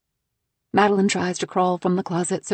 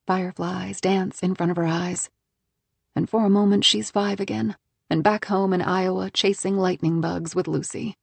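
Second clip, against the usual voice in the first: ordinary delivery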